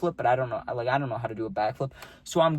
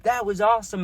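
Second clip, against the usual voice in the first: higher, louder cheering "yo"; hyped-up onlookers; 8 semitones higher, 4 dB louder than usual. Retold or imitated. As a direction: hype him up like a laughing crowd. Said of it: "They're like".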